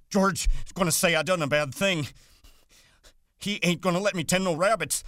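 put-on very high, loud childlike wail; crying with fright. A narrator's reading. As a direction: wail it like a terrified big child. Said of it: "began to cry with fright".

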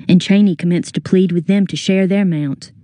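usual speaking voice

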